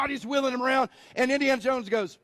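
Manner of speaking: ordinary delivery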